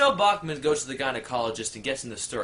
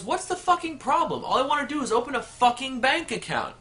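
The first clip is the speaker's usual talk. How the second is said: much higher, exasperated outburst; irate customer; 10 semitones higher than usual. Acted. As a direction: blow up at the teller. Customer loses temper.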